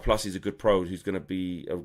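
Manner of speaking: ordinary talk, nothing marked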